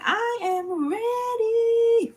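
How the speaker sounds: high, very slow sung-out declaration; drawn out. Real, not acted.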